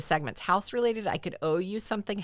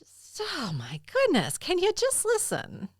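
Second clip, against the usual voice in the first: exasperated; 12 semitones higher, slower than usual. Real, not acted. Mock exasperation at co-host's interrupting.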